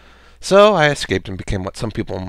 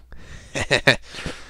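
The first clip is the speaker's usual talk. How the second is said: snickering laugh; 3 semitones higher, 3 dB quieter than usual. Acted. Deadpool after saying bang at Logan, snickering. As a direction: snicker to yourself.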